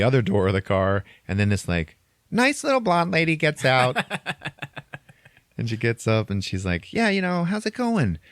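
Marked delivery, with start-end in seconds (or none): 2.3-8.2 high sugary voice; lightly voiced sugary manager; sweet lady. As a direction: sweeten it like a nice little lady.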